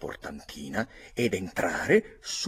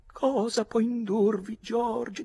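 high, pleading, impassioned woman's voice; passionate widow; 3 dB louder, slower than usual. A narrator's reading. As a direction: beg him like a jilted lover, voice raised high. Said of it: "gli rivolgeva parole vibranti di passione". (vedova appassionata, voce acuta e supplichevole)

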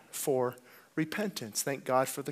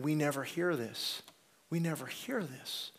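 hushed echo; 7 dB quieter than usual. Retold or imitated. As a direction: murmur what the people said. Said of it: "fiftieth time of hearing".

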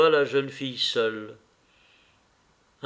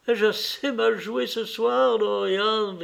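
higher and bright with a touch of elation; mild joyful cry; 9 semitones higher than usual. A narrator's reading. Sibyl greeting Dorian after her bad performance, mild happy cry. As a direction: call out to him, lightly triumphant. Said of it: "cria-t-elle".

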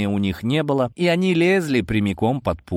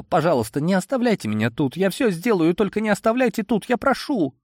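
high, fast, rising desperate plea; desperate pleading; 10 semitones higher than usual. A narrator's reading. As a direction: beg in a rush, near tears. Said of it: "её словно прорвало".

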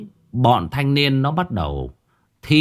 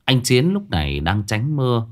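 ordinary delivery, nothing marked

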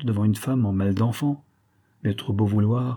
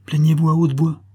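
louder, higher instruction; raised urging; 7 semitones higher, 5 dB louder than usual. A narrator's reading. Bonesetter tells the lady to complain aloud, raised urging. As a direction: urge her in a raised voice.

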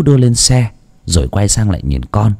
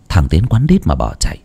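ordinary delivery

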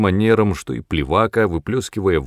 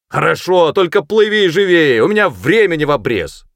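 high, loud hurrying call; impatient shout; 8 semitones higher, 6 dB louder than usual. A narrator's reading. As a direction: shout to hurry someone along. Sergeant hurries boy across river. (громко поторапливает криком)

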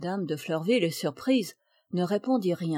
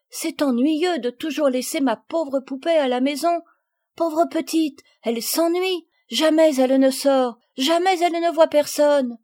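put-on high, loud childish lament for her doll; little girl complaining; 7 semitones higher, 6 dB louder than usual. Marguerite pleads to bring her lonely doll, complaining. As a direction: plead poor dolly's case, rising in force.

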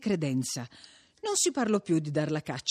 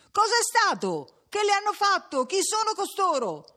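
high, clamouring crowd shout; shouted questions; 14 semitones higher than usual. A narrator's reading. Crowd shouting up to the curate at his window, clamouring. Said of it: "gli veniva gridato da cinquanta voci".

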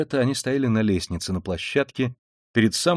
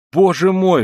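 high, loud exclamation of dismay; crying out; 9 semitones higher, 8 dB louder, slower than usual. A narrator's reading. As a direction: cry out in alarm. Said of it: "вскричала Пульхерия Александровна". (вскрикивая, высоко и громко)

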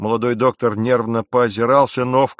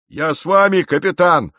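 high loud eager cry; shouted; 7 semitones higher, 6 dB louder than usual. A narrator's reading. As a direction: shout your loyalty. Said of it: "крикнул боцман". (громкий выкрик боцмана)

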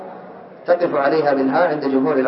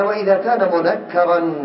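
usual speaking voice

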